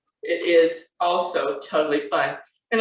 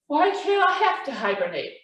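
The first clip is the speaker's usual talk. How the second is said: high little cub's objection; protesting Baby Bear; 9 semitones higher than usual. A narrator's reading. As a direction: object like a small child, pitched high.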